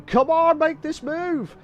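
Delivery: high, loud commentator's yell; shouting. Real, not acted. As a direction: shout it high, urging the driver on. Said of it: "He's probably heard me shouting, saying".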